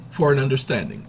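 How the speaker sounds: usual speaking voice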